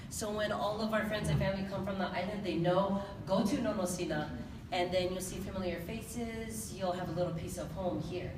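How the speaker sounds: usual speaking voice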